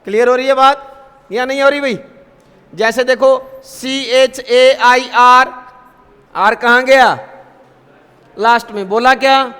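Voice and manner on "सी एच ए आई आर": slow, letter by letter; slow spelling for learners. Shown as a demonstration. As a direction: spell each letter out clearly. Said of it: "spelling out letters slowly".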